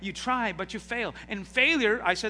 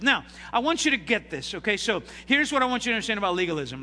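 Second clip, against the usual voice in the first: normal talking voice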